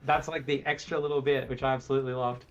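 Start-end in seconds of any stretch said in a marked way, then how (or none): none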